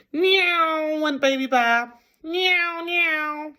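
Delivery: high squeaky meows; playful meowing. Acted. Baby Bear playing at night, high meowing. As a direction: meow high like a playful cub.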